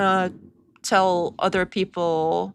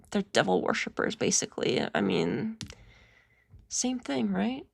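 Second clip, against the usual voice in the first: breathy mocking whisper, softer; sarcastic whisper; 7 dB quieter than usual. Mocking the woman's critics, in a sarcastic whisper. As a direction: let the punchline drop to a whisper.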